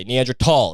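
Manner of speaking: "Neanderthal" said with a hard T, nee-AN-der-tall; correct pronunciation. Shown as a demonstration. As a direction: say "Neanderthal" with a T sound, not TH. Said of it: "that's the way it's pronounced".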